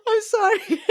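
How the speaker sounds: high-pitched, talking through laughter; laughing apology. Real, not acted. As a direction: squeak the words out while cracking up. Laughing fit interrupts the talk.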